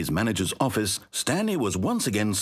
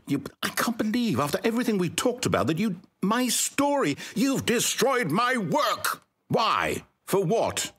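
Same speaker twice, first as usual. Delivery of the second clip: outraged creator over his destroyed work; distraught outburst; 10 semitones higher than usual. Acted. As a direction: rage at the player who wrecked it. Narrator distraught at ruined story.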